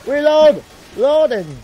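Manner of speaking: loud, urgent reload calls; battle shouts. Acted. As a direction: bark orders at the gun crew. Gun crew under fire.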